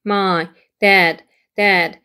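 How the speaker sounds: ordinary delivery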